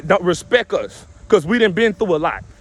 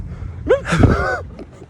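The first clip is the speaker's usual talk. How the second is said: a sudden high-pitched cackle. Real, not acted. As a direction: burst out laughing, way up high. Laughs at his own joke.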